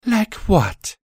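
high, cold demand; pry with a hint of venom; a suspicious mare; poisonous. A narrator's reading. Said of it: "sounded like poison".

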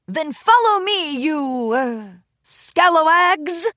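high, loud hammy pirate, slow on scalawags; dad's pirate attempt. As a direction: play a dad hamming up a pirate. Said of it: "attempt to sound like a pirate".

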